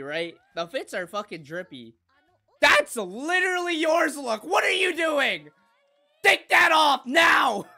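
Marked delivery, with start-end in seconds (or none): 2.6-7.7 loud scolding. Real, not acted. Scolding character on screen.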